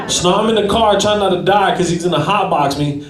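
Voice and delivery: ordinary delivery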